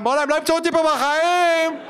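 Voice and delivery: high, loud panicked cry of the same words; sinking kid screams it out in terror; panicked shriek. Retold or imitated. Kid sinking in the sewage pit, panicked high shriek. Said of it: "וייב אחר לגמרי".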